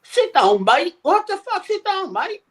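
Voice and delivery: high, sneering mock voice telling him to sit down; mocking the suited lads. Retelling the suited lads in the stadium, high mocking voice. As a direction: squeak it like a jumped-up fan in a suit. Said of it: "Two lads sitting beside me with suits on".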